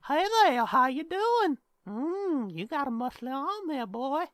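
put-on high, swooping creepy old-man voice; Herbert from Family Guy. Shown as a demonstration. Host demonstrating his cartoon character for jokes, high swooping voice. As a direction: croon it high, sliding up and down. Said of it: "I do Herbert from Family Guy".

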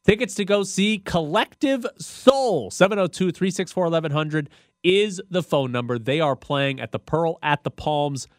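upbeat on-air giveaway read; radio contest promo. Real, not acted. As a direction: sell the ticket giveaway like a DJ. Giving away concert tickets on air.

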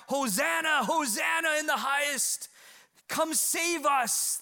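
high, loud shouts of praise; crowd crying out. Acted. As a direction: cry it out like a worshipping crowd. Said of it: "they were crying out".

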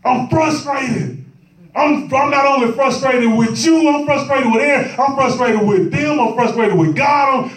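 high-pitched, exasperated complaint; frustrated disciple. Acted. Disciples complain to sleeping Christ, frustrated. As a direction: plead with mounting exasperation.

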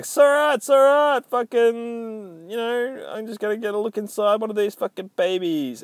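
high-pitched mock reassurance; brushing off objections. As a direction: wave everyone off in a high cartoonish voice. Reporter brushing off objections, acted.

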